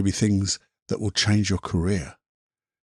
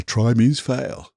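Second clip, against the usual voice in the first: slow quoted saying; much slower than usual. Retold or imitated. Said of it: "some people say".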